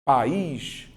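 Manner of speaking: "País" as pa-ís, the í stressed; two-syllable pronunciation. Shown as a demonstration. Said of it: "eu tenho duas sílabas".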